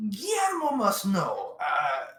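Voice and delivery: high, anxious third-person voice; nervous high character voice; Guillermo voice. Acted. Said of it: "It's like".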